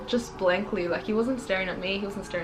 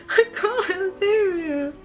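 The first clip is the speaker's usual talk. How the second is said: higher and louder, voice breaking into tears; real crying; 12 semitones higher, 6 dB louder than usual. Real, not acted. Mother telling viewers her baby turns one, crying. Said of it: "That's why I've been emotional".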